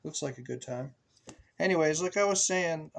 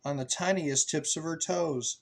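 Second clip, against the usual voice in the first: lilting read-aloud voice; reading to children; 3 semitones higher than usual. A narrator's reading. Parent reading a book to a child, lilting. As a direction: read it like a bedtime picture book.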